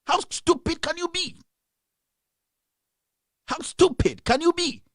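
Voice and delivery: high-pitched, exasperated outburst; outraged. Real, not acted. Outraged rant at leaders.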